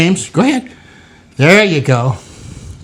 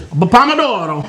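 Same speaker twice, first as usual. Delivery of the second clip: slow, drawn-out Italian "pomodoro"; Italian flourish. Shown as a demonstration. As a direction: stretch the stressed DOR in pomodoro with Italian flair. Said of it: "go like this".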